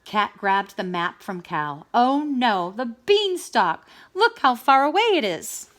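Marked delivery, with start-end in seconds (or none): 1.9-5.7 higher, louder dismay; Cat seeing the far beanstalk, louder dismay. Acted. Cat alarmed at map.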